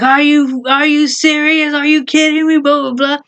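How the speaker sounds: mock shouting in outrage; imagined shouting. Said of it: "I thought I was gonna be shouting and screaming at him".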